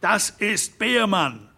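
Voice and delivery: narrator's half-voiced shout. A narrator's reading. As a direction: call out the name in alarm. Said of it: "schrie Hermann Derrick".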